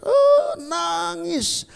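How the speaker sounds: high, drawn-out imitated wail; mock wailing. Preacher imitating the old pastor's wail, high and very slow. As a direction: act out the pastor bursting into a cry. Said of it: "tiba-tiba dia nangis".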